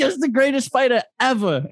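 high-pitched, exaggerated praise; hammed-up fight hype; mock hype. Voicing overblown praise of an opponent.

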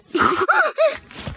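very high, wild witch cackle; evil cackle. A narrator's reading. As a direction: cackle in triumph. Witch laughs as Hansel collapses.

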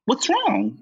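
high, concerned voice; Noah's worried question. Retold or imitated. Noah asking LaChance what's wrong, high and concerned. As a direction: ask with worry. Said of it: "so Noah says".

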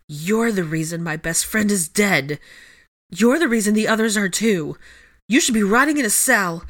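rising, bitter outburst; furious tirade. A narrator's reading. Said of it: "her tirade was interrupted".

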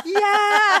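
very high, loud mock shriek; imitating hysterical laughter. Teller imitates husband's parents on speakerphone, hysterical mock cackle. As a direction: whoop it like parents dying laughing. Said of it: "they just start dying laughing".

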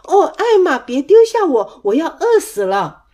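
loud plea, high then falling; crying out. A narrator's reading. Stranded cousin pleading with Elmer, crying out. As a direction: call out begging not to be left. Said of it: "韦伯叫道".

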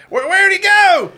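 high, loud, slow yell. Acted. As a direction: holler it across the country.

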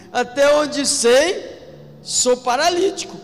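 high, loud and slow; paralytic's testimony. Pretend. Preacher voicing the healed paralytic, high and slow. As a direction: savor the faith of the healed man.

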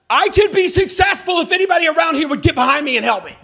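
loud, high, aggrieved whine; a staffer sure nobody backs him; self-pitying complaint. Retold or imitated. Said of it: "It's like".

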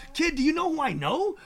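high-pitched imitation of an animated mogul; excited bragging. Mogul brags to young rapper about connections, excited and high. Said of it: "he was excited about what he does".

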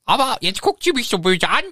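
high, hurt complaint; sulky Voldemort. Acted. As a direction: pout it out like a wounded villain. Voldemort jammert gespielt.

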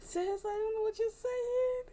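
slow, very high confused plea; telling a soft-spoken partner she can't hear her, very high. Retold or imitated. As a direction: stretch it out up high. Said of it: "I'm in very high talking".

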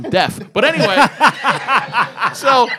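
ordinary delivery

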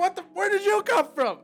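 high, loud startled outburst; shocked. Retold or imitated. Fan reacting out loud as a villain appears, startled. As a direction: jump at a sudden intruder. Retelling his shock watching episode.

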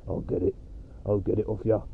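a hint of a gruff smoker voicing the dog; rough smoker builder dog voice. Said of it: "Reggie sounds like a rough builder that's been smoking".

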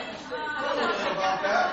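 laughing. Acted. Repeated teasing line, laughing.

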